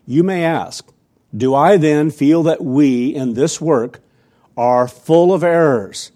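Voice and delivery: loud, deliberate sermon reading; emphatic reading aloud. Acted. Preacher reads a church leader's letter to congregation, emphatic. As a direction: read it out forcefully, weighing each phrase.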